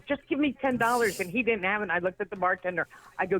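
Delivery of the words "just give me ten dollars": much higher, urgent retold plea; insistent demand. Comic to other comic who asked why, insistent and high-pitched. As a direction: press him for it, pitched well up. Repeats the request after "Why?.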